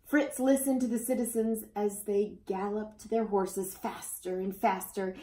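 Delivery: ordinary delivery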